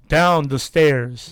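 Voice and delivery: slow, firmer machine voice; insistent, drawn-out spirit-box command; angrier voice box. Retold or imitated. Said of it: "Like angry and angry every time".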